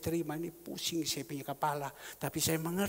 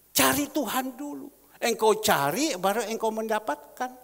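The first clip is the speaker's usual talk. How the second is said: loud, high exhortation to the congregation; seruan khotbah; 6 semitones higher, 7 dB louder than usual. Real, not acted. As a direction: preach it out forcefully, raised pitch. (seruan khotbah lantang bernada tinggi)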